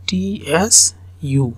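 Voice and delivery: টি এস ইউ spelled letter by letter, slowly. Shown as a demonstration. Teacher spelling টি এস ইউ slowly for students. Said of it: "উচ্চারণটা".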